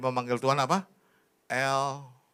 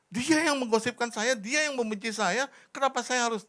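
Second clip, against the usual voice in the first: high, loud, whiny protest of innocence; indignant protest; 13 semitones higher, 11 dB louder than usual. Retold or imitated. Preacher retelling his indignant complaint to God, high and loud. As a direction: plead your innocence to God, voice pitched up and loud. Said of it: "saya bilang".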